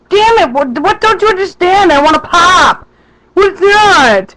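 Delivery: high-pitched, worked-up outburst; hammed-up retold exasperation; exasperated arguing. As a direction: argue it high, as if not being understood. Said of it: "we got in an argument".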